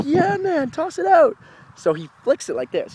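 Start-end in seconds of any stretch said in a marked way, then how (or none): 0.0-1.4 excited shout. Retold or imitated. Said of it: "I was like".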